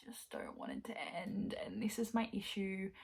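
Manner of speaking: sigh, then whisper it wistfully. Real, not acted. Sighing, hushed.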